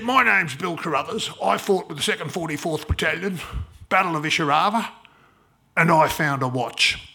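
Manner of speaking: put-on loud, rasping elderly caller; a very old man on the phone, gravel in the voice; gravelly old man voice. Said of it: "the gravelly voice of a very old man".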